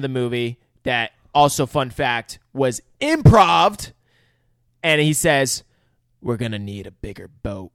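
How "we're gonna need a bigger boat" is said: a low, cool deadpan. Shown as a demonstration. Retelling the famous movie line, said cool. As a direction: play it cool and understated. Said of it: "I wanted to say it cool".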